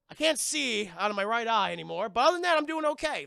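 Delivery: hammed-up dazed cheerfulness; derpy Maxie. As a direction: speed up brightly into the second half. Voices derpy injured Maxie.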